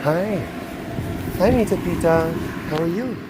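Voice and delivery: gentle high soothing voice; soothing a scared dog. Real, not acted. Calming an upset animal.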